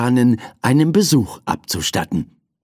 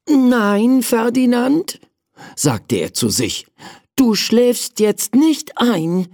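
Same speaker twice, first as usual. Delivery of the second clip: sleepy prince; 10 semitones higher than usual. A narrator's reading. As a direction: keep yourself awake, little prince. Prince talks to himself.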